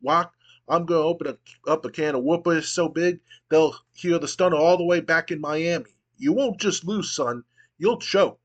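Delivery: building wrestling promo, slowing on the last line; Stone Cold promo. Acted. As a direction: hype the big match like a heel on the mic. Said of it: "and then he goes".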